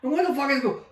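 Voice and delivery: high-pitched, cut-off question; retold baffled demand. Sergeant major questioning soldiers in fog, baffled demand. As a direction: demand an explanation, voice jumping up. Retells demanding what is happening.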